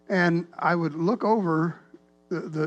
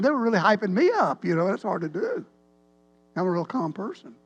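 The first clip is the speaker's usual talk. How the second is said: ordinary delivery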